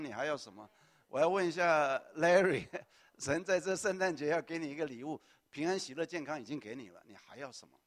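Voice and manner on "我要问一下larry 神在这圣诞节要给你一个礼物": loud teasing call to Larry, with a laugh; playful call-out. Real, not acted. Pastor loudly teasing Larry before the congregation, playful shout. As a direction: tease him loudly in front of everyone.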